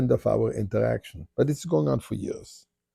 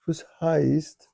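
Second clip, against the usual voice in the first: put-on Yiddish accent; 5 semitones higher than usual. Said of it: "again in perfect Yiddish".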